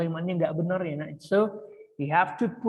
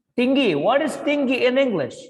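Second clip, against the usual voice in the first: calling out; 6 semitones higher, 6 dB louder than usual. Real, not acted. Teacher calling on class.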